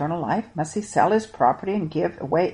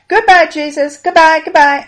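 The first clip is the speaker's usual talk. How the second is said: high, loud calling farewell; children calling goodbye to Jesus, high and loud; 9 semitones higher, 9 dB louder than usual. Acted. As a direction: call out after him as you walk off. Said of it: "I can hear them calling".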